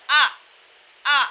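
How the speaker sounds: آ as a short, abrupt glottal stop; jerked hamza. Shown as a demonstration. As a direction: cut آ off with a jolt. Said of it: "جھٹکے والی آواز". (جھٹکے والا سادہ آ)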